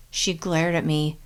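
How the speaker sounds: usual speaking voice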